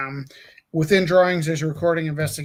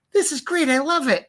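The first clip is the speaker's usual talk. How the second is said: high, gushing praise; mock enthusiasm; 9 semitones higher, 4 dB louder than usual. Praising your own work, high and gushing. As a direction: gush like you love it. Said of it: "when you go".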